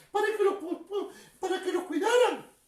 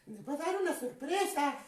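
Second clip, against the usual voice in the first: secretive; 6 semitones lower, 8 dB quieter than usual. Acted. Teasing secret of a surprise.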